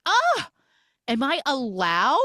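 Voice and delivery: high, loud, disbelieving question; astonished gasp. Retold or imitated. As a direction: act out a starstruck, incredulous newcomer. Voicing a thrilled cat's reply.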